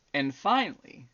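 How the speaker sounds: usual speaking voice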